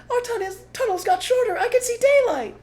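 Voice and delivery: very high, loud cub voice; high excited child fox; excited little fox. Acted. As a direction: squeal with discovery like a small fox. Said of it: "said one of the small foxes".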